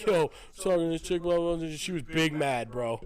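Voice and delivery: coworker voice. Retold or imitated. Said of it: "he was like".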